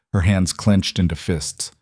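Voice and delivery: plain reading voice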